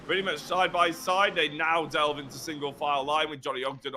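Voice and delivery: usual speaking voice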